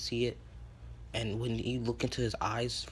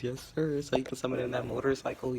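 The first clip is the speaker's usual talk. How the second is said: ordinary delivery, nothing marked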